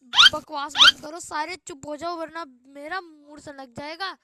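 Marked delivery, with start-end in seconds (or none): 0.0-1.2 much higher, much louder furious yell; angry shout. Acted. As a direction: snap the class into silence. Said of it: "ये कैसा गाना था भाई".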